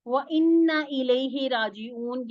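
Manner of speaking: slow melodic recitation; Quran recitation. Acted. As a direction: chant इन्ना slowly and drawn out. Teacher recites verse fragment.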